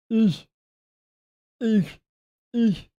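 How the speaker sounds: high falling seal bark. Retold or imitated. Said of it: "I can't do the little, like, fart noise".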